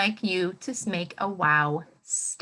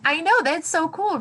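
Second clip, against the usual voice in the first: excited praise; 8 semitones higher, 5 dB louder than usual. Real, not acted. Praising a child's answer.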